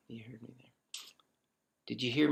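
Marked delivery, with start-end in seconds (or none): 0.1-0.7 right in your ear. Real, not acted. Mic test, close whisper.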